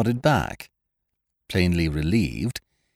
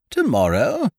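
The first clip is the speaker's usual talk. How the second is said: higher-pitched, rising disbelief; surprised question; 7 semitones higher than usual. A narrator's reading. Hostess hearing when Leonard returns, surprised. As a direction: query it with hopeful surprise.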